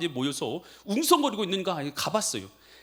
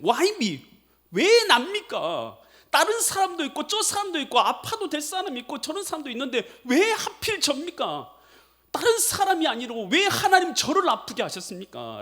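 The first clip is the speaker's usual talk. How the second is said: raised, pleading why-me lament; complaining prayer; 9 semitones higher, 3 dB louder than usual. Retold or imitated. Sick man complaining to God, pleading lament. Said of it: "원망을 섞인 기도를 드리게".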